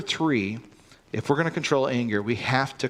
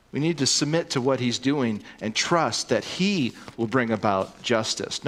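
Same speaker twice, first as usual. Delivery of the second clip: normal talking voice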